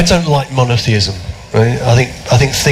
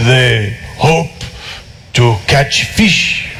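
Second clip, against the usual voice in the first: put-on slow, heavy French accent; much slower than usual. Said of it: "preposterous French accent".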